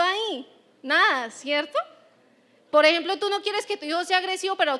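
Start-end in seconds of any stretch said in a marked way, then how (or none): none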